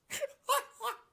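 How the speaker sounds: loud shrieking laugh; big real cackle; high cackling laugh. Real, not acted. Laughing again.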